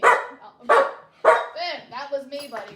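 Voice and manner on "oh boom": ordinary delivery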